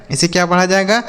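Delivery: normal talking voice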